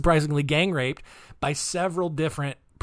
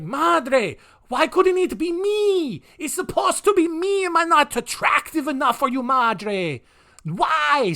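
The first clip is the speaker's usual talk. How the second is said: much higher, hammed-up South American accent, pleading slowly; bad South American accent; 11 semitones higher, 3 dB louder, slower than usual. The boy pleads with his mother in a much higher bad South American accent. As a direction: whine it in a silly fake South American accent. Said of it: "such a bad accent".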